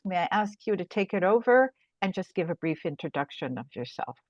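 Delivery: ordinary talk, nothing marked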